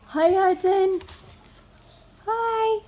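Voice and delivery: high, loud mommy voice. Real, not acted. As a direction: perk up for the little ones. Mother talking to her kids.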